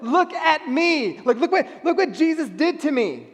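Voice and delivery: high, loud and thrilled, the first words stretched; excited exclaiming. Retold or imitated. Healed man showing everyone, loud and elated. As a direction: burst out with joy at being healed.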